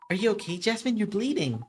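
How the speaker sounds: ordinary talk, nothing marked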